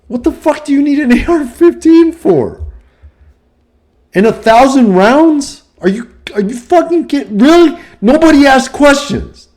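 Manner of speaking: put-on furious store clerk chewing out an eighteen-year-old; imagined outraged clerk. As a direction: blow up in disbelief at the kid. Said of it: "I would be like".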